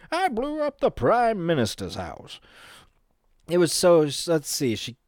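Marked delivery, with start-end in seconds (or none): 0.1-2.4 put-on old storyteller to kids. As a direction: reminisce grandly, pitched up. Same elder line, Canadian version.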